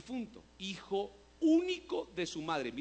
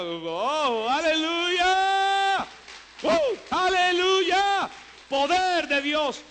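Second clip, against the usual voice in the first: long, high, full-voiced hallelujah cries; exultant shout; 13 semitones higher, 14 dB louder, much slower than usual. Real, not acted. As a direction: shout praise at the top of your voice, drawn out. Urge a celebrar el milagro. (grito de júbilo, agudo y prolongado)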